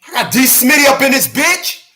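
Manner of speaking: high excited shout-out; hype intro. Real, not acted. Host hyping his sister joining the stream, high excited. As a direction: crank it up, hyped to show her off. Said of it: "All right".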